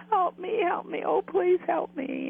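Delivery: high-pitched cry for help, retold; imitated desperate plea. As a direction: mimic someone lost and begging for rescue. Voices trapped spirit begging for rescue.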